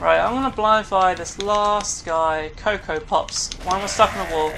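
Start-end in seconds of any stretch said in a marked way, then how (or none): none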